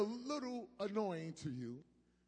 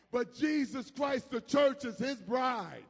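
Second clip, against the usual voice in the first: louder and louder, slowing on the last words; rising preaching shout; 2 semitones higher, 6 dB louder than usual. Real, not acted. Preacher crying out to the church that it is Christ's bride. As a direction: build to a proclamation.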